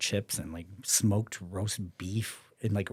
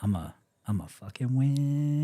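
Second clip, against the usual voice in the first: higher, puffed-up inner boast; cocky inner voice; 5 semitones higher, 3 dB louder than usual. Retold or imitated. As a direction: think it like you've already won. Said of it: "So in my head".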